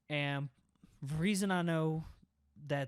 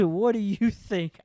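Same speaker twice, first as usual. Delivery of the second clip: higher and louder, slow, cracking up; imitating sassy retort; 6 semitones higher, 12 dB louder than usual. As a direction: replay her comeback while half laughing. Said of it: "she says".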